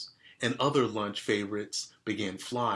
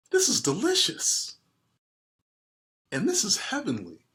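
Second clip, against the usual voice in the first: much higher, swooning praise; delighted; 12 semitones higher, 6 dB louder than usual. Acted. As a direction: savor it out loud. Girls tasting new food.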